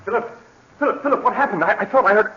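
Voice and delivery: alarmed cry. Acted. Brother bursting in after the gunshot, alarmed and high. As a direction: panic rising as you find her. Brother finds sister shot.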